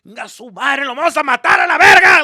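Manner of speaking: dad hollering in Spanish on the coaster; rowdy shout. Retold or imitated. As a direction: yell it like a thrilled rowdy dad. Dad cursing excitedly on ride.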